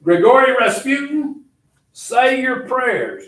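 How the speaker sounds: high, loud, slow dramatic challenge; retold yell. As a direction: bellow it as an executioner's call. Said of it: "he just yells".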